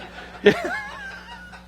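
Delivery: short high laugh at his joke. Real, not acted.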